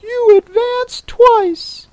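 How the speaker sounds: very high-pitched creature voice; Mock Turtle. A narrator's reading. Mock Turtle explains the lobster quadrille to Alice, very high voice.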